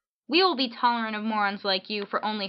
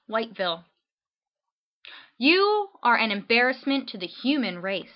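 normal talking voice

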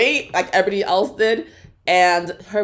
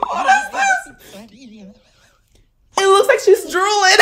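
high squeal through laughter; real laughing disbelief; 11 semitones higher than usual. Real, not acted. Reacting to the singer drooling, laughing in disbelief. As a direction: crack up while saying it, pitched way up.

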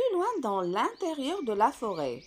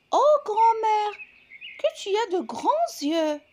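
put-on astonished girl; 9 semitones higher, 5 dB louder than usual. A narrator's reading. Girl marvels at grandmother.

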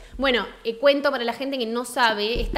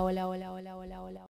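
usual speaking voice

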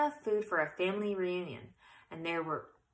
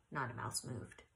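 ordinary delivery, nothing marked

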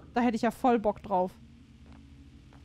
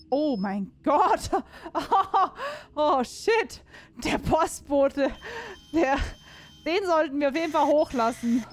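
excited outburst; 5 semitones higher, 4 dB louder, slower than usual. Real, not acted. Excited at postman figure.

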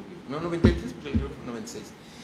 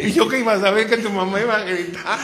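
laughing retort; 7 semitones higher, 7 dB louder than usual. Retold or imitated. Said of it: "Susana's reply".

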